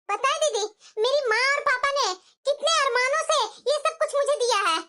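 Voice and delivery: normal talking voice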